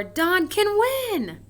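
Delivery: excited exclamation. Shown as a demonstration. Teacher contrasting question with exclamation, excitedly. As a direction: declare it proudly. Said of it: "so you change the wording".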